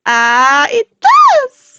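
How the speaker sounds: loud high cry of delight; real joyful exclamation; excited shout. Real, not acted. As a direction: burst out thrilled that it works. Delighted the trick works.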